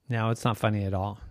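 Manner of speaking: low, flat dismissal, a bit quick; unimpressed friends. Acted. Friends telling Dora her joke isn't funny, unimpressed.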